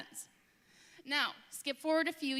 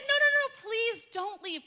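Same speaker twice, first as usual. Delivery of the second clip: high, louder urgent pleading; 9 semitones higher, 8 dB louder than usual. Retold or imitated. Greeter begging the family to stay, high urgent pleading. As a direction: beg them not to go.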